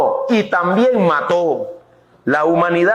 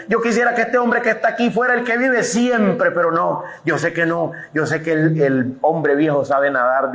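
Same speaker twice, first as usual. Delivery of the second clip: ordinary delivery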